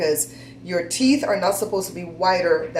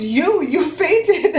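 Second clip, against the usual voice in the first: imitating an Indian accent, much higher and very slow; 10 semitones higher, 5 dB louder, much slower than usual. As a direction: draw out the dentist's words in a mock Indian accent. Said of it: "his Indian accent".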